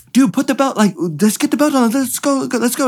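high-pitched, urgent hurrying without yelling; amped up, excited. Retold or imitated. Driver urging his crew to get the belt on, amped up. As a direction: imitate an excited driver trying to get things going. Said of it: "amped up, not yelling at me".

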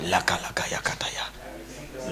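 whispered mock speaking in tongues. Pastor mocking a praying member, whispered. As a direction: hush it like secret prayer.

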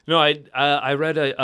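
ordinary talk, nothing marked

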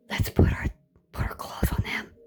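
sinister whispered plotting; scheming whisper. Retold or imitated. Voicing narcs plotting to seize a new victim in a whisper. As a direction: whisper it like a predator.

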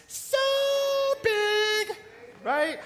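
high, loud, stretched-out "big"; stretched excited so big. Real, not acted. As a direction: pull "big" way up to show how huge. Showing how big God's love is.

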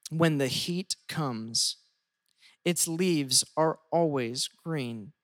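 slow, deliberate scripture reading. Acted. Pastor reading Jeremiah to his church, slow and reverent. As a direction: read each phrase slowly with pauses. Reads Jeremiah aloud slowly.

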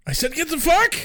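high, exaggerated outburst; hammed-up angry repeat; mock indignant yell. Irate admin ordering students out, mock indignant.

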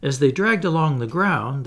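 usual speaking voice

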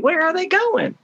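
high, fast, eager voice of curious people; high-pitched and quick, an eager crowd wondering aloud; curious onlookers. Retold or imitated. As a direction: pitch it way up like excited gawkers asking each other. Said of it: "they're saying".

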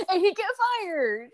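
real laugh spilling into the words. Real, not acted. To the co-host, laughing over the punchline. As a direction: giggle through the punchline. Laughing at her own story.

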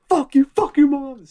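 high, loud retold yelling; imitated furious shouting; going ballistic. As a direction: yell it like a comic snapping at a heckler. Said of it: "Was that you go ballistic".

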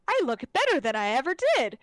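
leprechaun voice. Retold or imitated. Reborn leprechaun admiring his new look, gloating loud. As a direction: crow it like a smug reborn leprechaun. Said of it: "he makes the statement and says".

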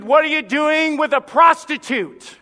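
loud, high, slow rebuke; an outraged preacher's rebuke; stern rebuke. Retold or imitated. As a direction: thunder the question at him. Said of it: "he said".

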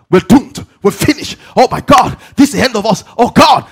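loud, high panicked cries; mock panic. Preacher acting out crash passengers, loud mock panic. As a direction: act out doomed passengers screaming. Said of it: "As the plane was going to crash".

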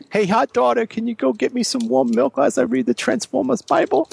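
high-pitched put-on character voice; mock devout bedtime reader. Reader asks daughter for warm milk, high character voice.

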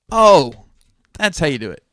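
high, loud eureka "oh!"; re-enacted aha moment; sudden realisation. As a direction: burst out as if it just clicked. Acts out his sudden realisation.

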